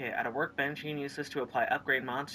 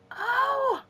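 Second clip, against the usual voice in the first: delighted; 6 semitones lower, 6 dB louder, much slower than usual. Real, not acted. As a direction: gasp with delight. Delighted by upgrade result.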